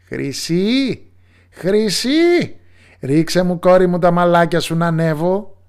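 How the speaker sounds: loud, high witch call up the tower. Acted. Witch calls her daughter from below the tower, loud. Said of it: "φώναξε".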